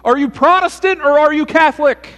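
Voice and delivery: high, loud gushing delight; thrilled to find a fellow believer; delighted rescuer. Acted. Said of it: "The man said".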